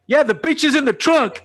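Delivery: loud, very high-pitched blurt; imitated brash shout. Passenger yells a joke at the cop, imitated brashness.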